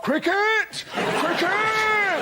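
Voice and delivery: high-pitched, drawn-out cry; hammed-up posturing cheer; gleeful call. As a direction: sing out the word high and long. Telegraph acted crying out cricket.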